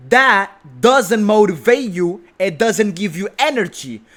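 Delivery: ordinary talk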